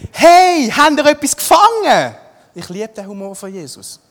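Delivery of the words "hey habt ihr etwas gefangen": loud, very high shouted question; hammed-up far-carrying hail; calling out. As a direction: holler it across the water. Acting Jesus calling to the fishermen.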